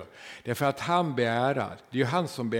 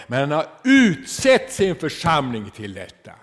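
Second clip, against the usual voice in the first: loud, raised preaching voice; full-force preaching; 5 semitones higher, 8 dB louder than usual. Acted. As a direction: hammer it home to the church.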